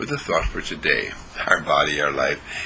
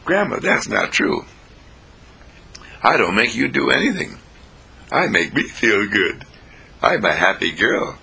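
higher-pitched child protest, lightly acted; granddaughter; 3 semitones higher than usual. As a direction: pitch up like a little girl, then settle. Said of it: "And the granddaughter says".

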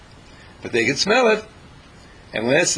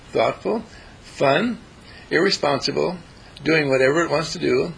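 usual speaking voice

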